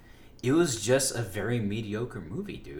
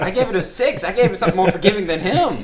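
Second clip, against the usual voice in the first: laughing; 9 semitones higher, 9 dB louder than usual. Real, not acted. Laughing while comparing scores.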